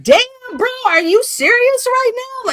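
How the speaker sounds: high, loud incredulous outburst; hammed-up astonished protest; retold disbelief. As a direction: exclaim it way up high, acting out your shock. Retelling own shocked reaction to him.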